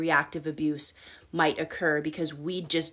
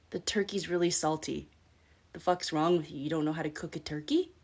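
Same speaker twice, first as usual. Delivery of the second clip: quiet mocking insult; 3 semitones lower, 5 dB quieter than usual. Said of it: "something my ex said to me".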